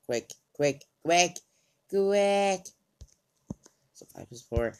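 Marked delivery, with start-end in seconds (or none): 0.1-2.7 quick urgent panic getting higher and louder; panicked, rising. Real, not acted. Panicked urging while chased.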